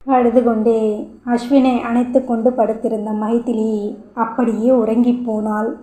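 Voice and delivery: ordinary delivery, nothing marked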